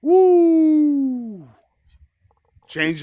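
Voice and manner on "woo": loud whoop. Real, not acted. Hyped about hotel AC.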